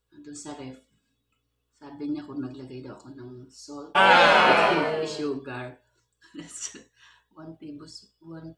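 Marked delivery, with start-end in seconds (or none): 3.9-5.0 sudden loud yelped ah; a burst of mock alarm; shocked yelp. Retold shock at salt.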